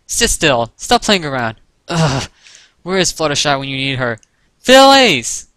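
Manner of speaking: scold, then yell out the last word; exasperated scolding. A narrator's reading. Rarity chases the fillies, scolding in exasperation.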